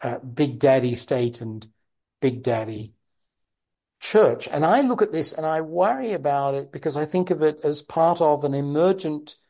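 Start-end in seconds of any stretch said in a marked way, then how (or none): none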